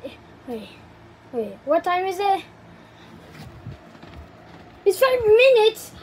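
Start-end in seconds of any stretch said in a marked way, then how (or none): 4.9-5.9 high, fast, giddy outburst; thrilled and high-pitched; excited. Acted. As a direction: race through it with rising excitement. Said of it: "I'm so excited".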